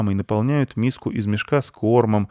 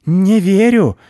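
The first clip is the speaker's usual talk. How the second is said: much higher, much louder yell; shouting; 12 semitones higher, 10 dB louder, slower than usual. Acted. Squirrel yells her disbelief at the boy. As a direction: yell it in defiance. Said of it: "кричала белка".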